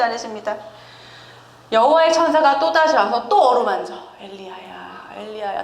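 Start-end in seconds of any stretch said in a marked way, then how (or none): 4.2-5.6 soft coaxing call of 엘리야야; soothing angel. Retold or imitated. Angel gently wakes the exhausted prophet, tender and soft. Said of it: "달래고".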